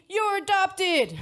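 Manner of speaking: high, drawn-out teasing call; mock sibling taunt. Comic retelling her brother's childhood jab, mock taunting call. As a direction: imitate a brother taunting, stretching the words high.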